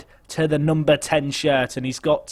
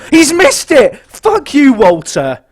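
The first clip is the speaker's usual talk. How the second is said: furious screaming, much higher and louder; anguished yell; 10 semitones higher, 11 dB louder than usual. Real, not acted. Streamer screaming at his striker after a missed penalty, furious. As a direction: rage and heartbreak at full volume.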